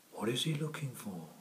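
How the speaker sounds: ordinary delivery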